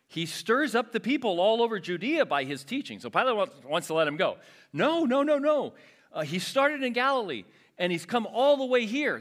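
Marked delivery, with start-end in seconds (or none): 0.1-3.0 higher, louder insistent accusation; insistent crowd. Acted. 4.7-9.2 much higher, louder frantic protest; crowd protesting. Acted. Crowd refuses Pilate's release.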